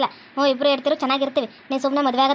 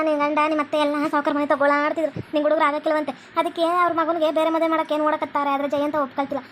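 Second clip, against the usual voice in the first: ordinary delivery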